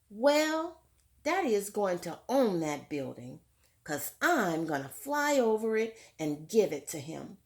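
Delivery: clear, fuller read-aloud voice to the class; story narration. A narrator's reading. Teacher reading Tar Beach to her class, lively read-aloud. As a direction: read it out like a picture book for children. Reads book text aloud.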